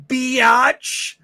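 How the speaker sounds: drawn-out cheeky taunt; sassy insult. Retold or imitated. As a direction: throw it like a punchline. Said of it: "you should have said".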